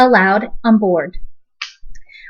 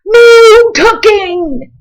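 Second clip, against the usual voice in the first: high, loud, very slow crew cry; the crew chanting their refusal; rowdy pirates shouting no; cried; 11 semitones higher, 7 dB louder, much slower than usual. A narrator's reading. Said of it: "the crew cried".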